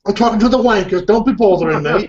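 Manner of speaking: a cheeky cartoon Brit grumbling; English accent. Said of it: "do an English accent".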